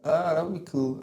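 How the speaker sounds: usual speaking voice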